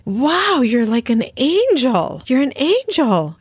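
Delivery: high, wide-eyed quote of the workers; amazed workers. Retold or imitated. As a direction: act out the workers marvelling, voice lifted high. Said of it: "they looked at me and were like".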